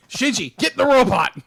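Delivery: loud, high comic order; mock barked command. Evangelion fan quoting an order to Shinji, mock shout. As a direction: shout it like an anime quote.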